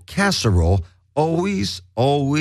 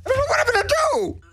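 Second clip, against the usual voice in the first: mock desperation; 10 semitones higher, much faster than usual. Acted breakdown at pestering effects.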